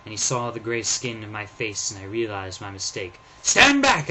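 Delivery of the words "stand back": loud, high command; ordering. Acted. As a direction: bark a warning to back off. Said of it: "I ordered".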